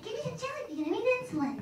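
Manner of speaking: put-on high, pleading childish beg; desperate kid. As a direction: beg the teacher for a jelly bean in a high kid voice. Diabetic kid begging.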